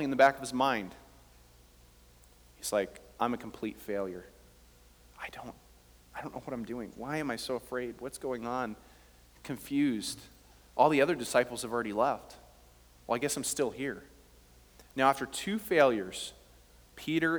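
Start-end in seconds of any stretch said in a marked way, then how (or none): 3.2-8.7 low, hushed self-doubt; soft, low voice of someone lost in thought; despairing inner voice. Acted. 13.1-14.0 quiet, low resignation; mildly deflated, low murmur; resigned inner thought. Acted. Peter's inner thought, left alone.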